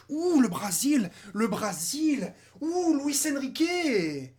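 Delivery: slow, drawn-out ouh and names; relishing the names slowly; savouring names. Real, not acted. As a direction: linger on each name with delight.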